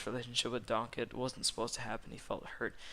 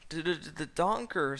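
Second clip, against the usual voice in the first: stammering, higher and louder; worried ogre; 5 semitones higher, 5 dB louder, slower than usual. A narrator's reading. Worried ogre reacting to donkey's news, exaggerated stammer. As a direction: stutter it out in mock worry. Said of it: "the worried ogre".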